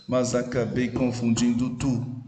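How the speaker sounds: "Mas acabei confundindo tu" spaced out syllable by syllable; syllable counting. Shown as a demonstration. Teacher counting nine syllables for the class, measured scansion of "Mas acabei confundindo tu". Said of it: "nove sílabas".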